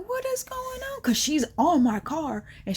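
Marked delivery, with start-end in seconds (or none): 0.1-1.0 much higher, panicked question; freaking out. Retold or imitated. Can't reach girlfriend with the car, panicked. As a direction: let the panic spike the pitch. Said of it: "So I'm just freaking out".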